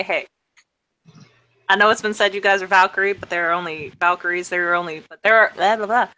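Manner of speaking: ordinary delivery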